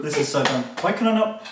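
ordinary talk, nothing marked

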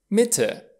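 "Mitte" with a short, high-pitched i. Shown as a demonstration. Teacher models I before double consonant, "Mitte" with short i. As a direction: say "Mitte" with a quick first vowel. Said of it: "litera I se pronunță scurt".

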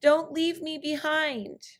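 high, pleading coyote voice; desperate plea. Acted. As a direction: beg not to be left behind. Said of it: "he cried out".

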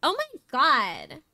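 put-on slow, drawn-out valley girl; mock valley girl drawl; valley girl accent. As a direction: stretch the vowels like a valley girl. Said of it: "Valley girl accent?".

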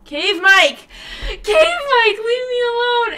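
high, loud whiny wail; mock wail. Real, not acted. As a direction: wail at the cursed mic. Despairing at echo mic.

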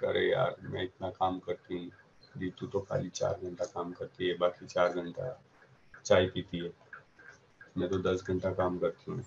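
quick, quiet, grumbling rant; grumbling complaint. Retold or imitated. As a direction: mutter the complaint fast. Employee complaining about coworker.

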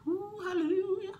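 ordinary talk, nothing marked

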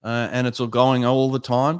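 ordinary delivery

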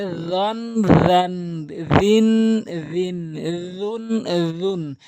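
রন, রিন, রুন begun with a long buzzing th as in "this"; tongue tip on front teeth, hold the buzz in রন, রান, রিন. Shown as a demonstration. Teacher shows correct letter, drawn-out dental buzz in রন, রিন, রুন. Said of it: "জিহ্বার আগা দাঁতের আগা থেকে".

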